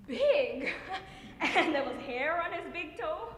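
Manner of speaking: loud, laughing marvel; fond, laughing astonishment; amused delight. Acted. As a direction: burst out laughing as you recall it. Mother fondly recalls son's big foot.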